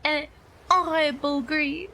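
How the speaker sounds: hammed-up, mock French accent; horrible French accent. Streamer playing up a horrible accent redeem for chat, hammed-up French accent. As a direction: put on a deliberately bad French accent. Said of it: "It wouldn't be French".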